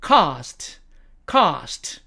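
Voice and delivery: British "cast" with long ah; British demo of "cast"; British accent. Shown as a demonstration. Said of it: "영국식 발음으로는".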